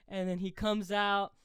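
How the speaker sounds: ordinary talk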